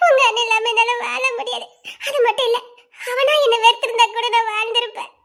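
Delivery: distraught sobbing confession; tearful, gasping between phrases; crying. Acted. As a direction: pour it out through tears. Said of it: "நீ எதுக்கிட்ட அழுதுட்டு வர".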